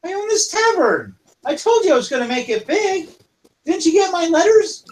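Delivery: high, proud voice; lightly voiced proud tavern owner; female kobold. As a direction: boast to an old friend, high-pitched. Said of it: "She goes".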